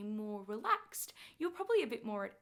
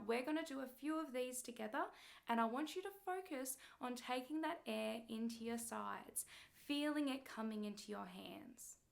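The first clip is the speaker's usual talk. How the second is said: ordinary talk, nothing marked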